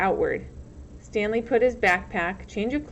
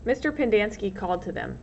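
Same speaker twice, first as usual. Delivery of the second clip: usual speaking voice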